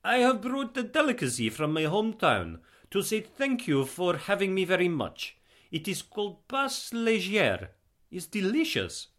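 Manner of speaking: put-on proud guest in Eastern European accent. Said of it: "heavy Eastern European accent".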